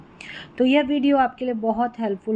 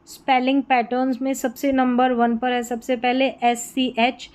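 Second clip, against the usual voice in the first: ordinary talk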